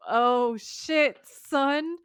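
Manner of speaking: loud, high reaction to a reveal; mock shock. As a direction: act out a viewer's stunned exclamation. Viewers react to demon reveal.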